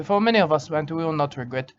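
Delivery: normal talking voice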